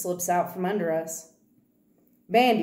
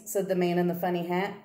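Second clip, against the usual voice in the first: ordinary delivery, nothing marked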